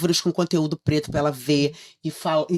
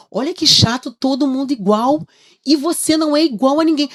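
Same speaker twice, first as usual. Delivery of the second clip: emphatic encouragement; 10 semitones higher, 8 dB louder than usual. Retold or imitated. Mother encouraging daughter emphatically.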